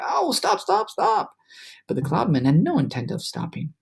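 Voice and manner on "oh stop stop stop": high, pained pleading; pleading cry. A narrator's reading. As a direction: beg them to stop, hurting. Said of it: "he cried".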